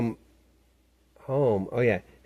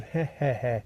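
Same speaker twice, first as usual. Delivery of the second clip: imitated laugh; 3 semitones higher, slower than usual. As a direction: turn the cry into a chuckle. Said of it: "It switches to a laugh".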